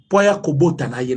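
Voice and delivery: ordinary delivery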